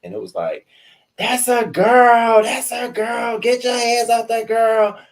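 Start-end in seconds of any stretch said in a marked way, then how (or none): 1.2-5.0 high, stretched-out yelling of the onlookers; frantic crowd yell. Retold or imitated. Onlookers at the school fight screaming to break it up, high frantic yell. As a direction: shriek it like kids watching a fight break out.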